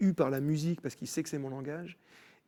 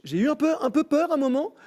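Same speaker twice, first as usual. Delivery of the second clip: mock fright; 12 semitones higher, 10 dB louder than usual. God joking about a close call.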